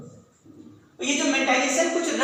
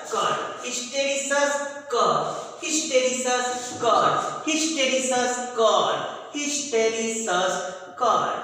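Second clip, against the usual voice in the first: hysteresis cor in very slow, separated syllables; chant-like repetition, pausing before each cor; slow dictation; much slower than usual. Shown as a demonstration. Teacher repeats the curve's name for students, dictating very slowly.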